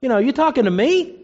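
mock incredulous denial. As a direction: act out a puzzled "who, me?". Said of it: "Peter denied it and said".

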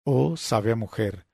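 usual speaking voice

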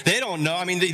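usual speaking voice

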